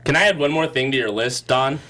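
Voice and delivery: normal talking voice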